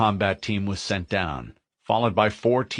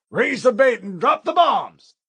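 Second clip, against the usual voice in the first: shouting; 14 semitones higher, 5 dB louder than usual. Acted. Sergeant orders the bombing.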